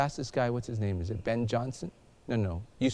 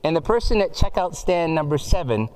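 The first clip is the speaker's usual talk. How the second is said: loud, higher store announcement over the speakers; cheery loudspeaker announcer voice; 5 semitones higher, 5 dB louder than usual. Acted. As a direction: boom it out like a supermarket PA. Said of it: "a voice comes through the speaker".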